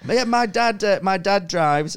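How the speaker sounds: high, loud Irish boy impression; Irish lad. Irish kid telling the gamer about his dad, high Irish voice. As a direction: answer like an earnest Irish kid. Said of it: "He was this Irish".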